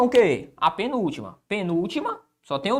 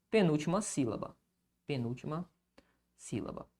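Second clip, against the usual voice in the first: very quiet, fading murmur; dictating; 5 semitones lower, 13 dB quieter than usual. Real, not acted. Teacher finishing the written definition, hushed dictation. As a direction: say it softly to yourself while writing. Murmuring words while writing.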